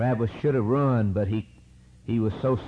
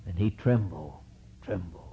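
lower, fading to almost nothing; hushed trembling; 5 semitones lower, 6 dB quieter than usual. A narrator's reading. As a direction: let it sink away in dread. Fear described, voice drops.

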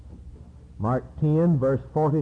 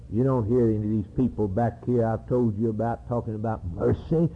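ordinary talk

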